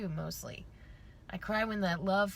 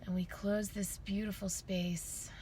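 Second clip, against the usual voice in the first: calm closing meditation; guided meditation; 5 dB quieter than usual. Acted. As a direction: guide them gently into stillness.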